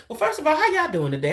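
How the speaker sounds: a hint of a prim presenter; polished professional greeting. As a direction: imitate her smooth, proper on-air hello. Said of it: "You was like".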